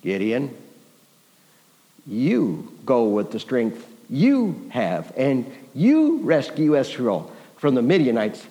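slow, low, solemn command with weighty pauses; the Lord. Acted. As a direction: pronounce it as a divine commission, leaning on each "you". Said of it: "It's the Lord Almighty that responds".

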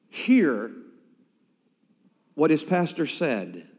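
usual speaking voice

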